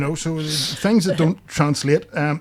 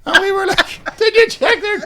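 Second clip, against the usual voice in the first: very high, loud squealing through laughter; reliving the Kojak moment while squealing with laughter; 8 dB louder than usual. Real, not acted.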